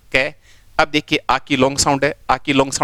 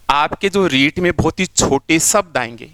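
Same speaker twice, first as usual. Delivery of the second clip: ordinary talk, nothing marked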